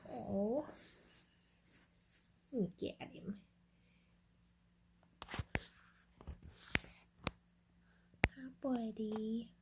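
soft, slow whisper to the cat. Real, not acted. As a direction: whisper tenderly to a resting pet.

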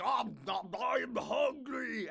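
slow, drawn-out dopey voice; dopey fat guy voice. Retold or imitated. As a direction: groan it out like a lazy glutton. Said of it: "and he's like".

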